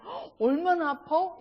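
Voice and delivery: slow worried sympathy; caring gasp. Retold or imitated. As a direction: sound truly concerned for her pain. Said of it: "제가 듣고 싶은 말".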